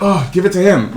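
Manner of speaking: high-pitched exasperated concession. Acted. Acted giving up at auditions.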